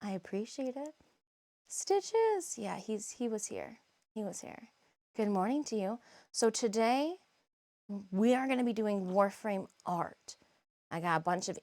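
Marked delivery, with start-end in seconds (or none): none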